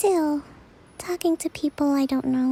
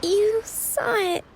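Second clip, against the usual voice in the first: slow, higher, pointed accusation; suspicious; 6 semitones higher, slower than usual. Acted. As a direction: narrow it down on them, half embarrassed. Suspicious after embarrassing book.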